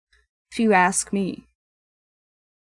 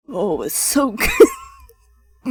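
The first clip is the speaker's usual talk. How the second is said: attempted husky voice; 4 dB louder, much slower than usual. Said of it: "I'm trying to".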